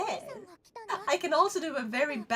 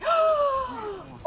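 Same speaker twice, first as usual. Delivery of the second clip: sharp high gasp, much louder; excited loud gasp; 8 dB louder than usual. Real, not acted. On hearing a chatter is Finnish, a loud excited gasp. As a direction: gasp in delight.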